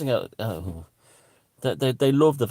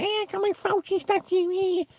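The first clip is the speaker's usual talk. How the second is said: very high, thin mocking voice, softer; mocking squeal; 4 dB quieter than usual. Comedian mimicking a Fauci fan, mocking high squeal. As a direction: squeal it in a silly put-on voice. Mocks someone in put-on squeal.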